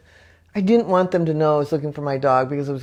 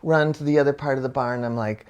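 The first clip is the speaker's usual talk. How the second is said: ordinary delivery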